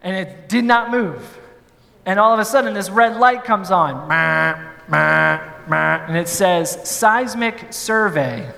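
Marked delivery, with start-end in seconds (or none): 4.1-6.0 low droning mock alarm buzz, meh meh; imitated elevator buzz. Storyteller imitates the stuck elevator's alarm, low droning buzz. 6.8-8.5 slow, deliberate machine announcement; robotic elevator voice. Retold or imitated. The elevator announces its fault in a slow robotic voice.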